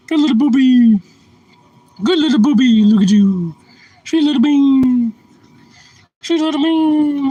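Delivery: high, loud squeaky pet talk. Real, not acted. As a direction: gush at the dog in a squeal. Baby talk to dog.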